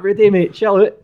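high, loud appeal to calm down; pleading through laughter. Retold or imitated. Player shouting at Rudy to chill, high and loud. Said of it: "I'm like".

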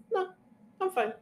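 sing-song fake cheer, laughing at the end; mock cheery denial. Mocking patients who say they're fine, fake cheerful brightness. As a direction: chirp it like everything's fine.

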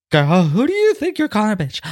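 mock diva comeback; sassy diva retort. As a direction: clap back like a catty diva.

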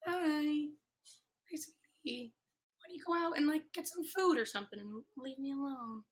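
high, sing-song pet voice; cooing to pet. Real, not acted. Podcaster coaxing her cat to leave, cooing.

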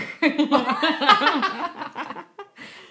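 loud, high laugh breaking out; high burst of laughter. Real, not acted. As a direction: crack up at your own bit. Laughing at own accent.

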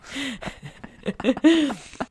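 big burst of laughter; loud laugh. Real, not acted. Laughs at own joke.

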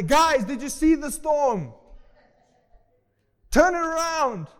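loud, high, drawn-out cry of alarm; mock panic. Preacher acting the panic Jesus did not show, shouted. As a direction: holler a hammed-up storm warning. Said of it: "He's not running out of there".

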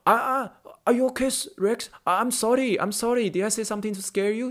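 apologetic Indian patient. Retold or imitated. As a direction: imitate a worried patient apologizing. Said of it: "他们就说".

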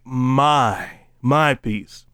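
"my" set apart and held; emphatic MY. Shown as a demonstration. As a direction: lean on "my" as the word that matters. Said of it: "repeats it with the emphatic".

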